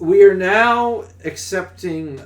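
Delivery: loud, high, slow announcer parody; drawn-out mock-official proclamation; mock announcement. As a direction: proclaim it like a public notice. Mock announcement to replace the co-host.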